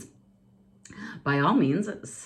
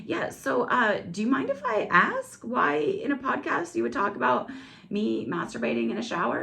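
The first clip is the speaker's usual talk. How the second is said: lightly voiced ex; 8 semitones higher than usual. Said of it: "he was like".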